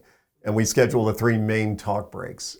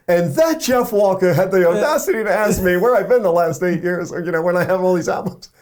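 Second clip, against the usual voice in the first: higher, louder indignant British rock star; 7 semitones higher, 4 dB louder than usual. Retold or imitated. [name] complaining about [name].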